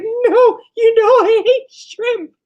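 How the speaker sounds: put-on high, loud, slow whiny kid; hammed-up daughter protest; mock whine. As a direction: wail it like a child refusing food.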